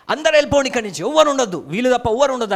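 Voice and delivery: high, forceful dismissal; loud shout. Acted. Joseph ordering all but his brothers to leave, shouting. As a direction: shout them out of the room. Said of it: "బిగ్గరగా చెప్పి గట్టిగా అరిచాడట".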